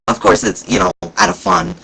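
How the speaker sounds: ordinary talk, nothing marked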